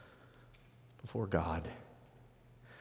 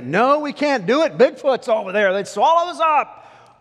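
high, loud cry of alarm; panicked protest; 12 semitones higher, 9 dB louder than usual. Acted. The ten spies warning they'll be swallowed up, panicked. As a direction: yell it like a hammed-up doomsayer.

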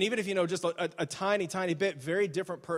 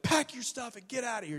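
higher, hammed-up angry dismissal; mock screaming firing; 7 semitones higher than usual. Boss firing a salesman, mock scream. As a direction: throw him out. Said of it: "He just starts screaming and stuff".

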